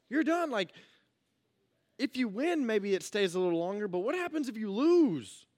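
high, loud and fast, firing up the crowd; energetic youth pastor hype; preaching at full force. Acted. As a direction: preach it big like a youth rally.

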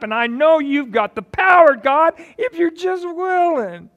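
high, loud, fervent plea; preacher acting out the leper begging God loudly; earnest pleading leper. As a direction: plead with full power.

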